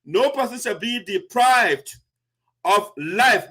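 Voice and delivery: slow, deliberate reading of No person shall be deprived; measured, unhurried legal reading; read slowly. Shown as a demonstration. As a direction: spell out each word of the article slowly. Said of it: "I'll read it slowly".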